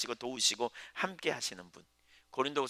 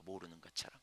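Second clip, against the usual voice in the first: quiet echo; 6 semitones lower, 15 dB quieter than usual. Shown as a demonstration.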